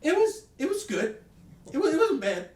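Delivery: high, loud mock voice of a friend; imitating Kevin. Retelling what Kevin said after the movie, in a high loud mock voice. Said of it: "he goes".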